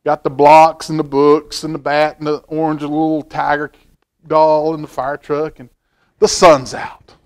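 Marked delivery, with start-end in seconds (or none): none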